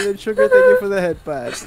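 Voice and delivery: tearful thanks dissolving into laughter; crying with laughter. Real, not acted. Streamer thanks viewer for head pat, crying with laughter. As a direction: thank them while laughed to tears. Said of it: "I'm crying while laughing".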